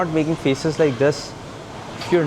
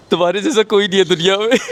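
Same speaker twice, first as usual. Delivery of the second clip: excited gushing praise; 6 semitones higher, 6 dB louder, much faster than usual. Retold or imitated. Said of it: "I was like".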